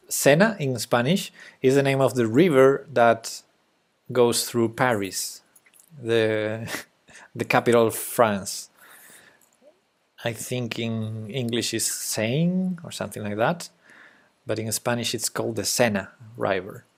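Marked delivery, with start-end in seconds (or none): none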